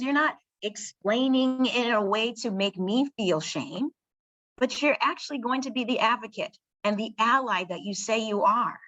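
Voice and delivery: ordinary delivery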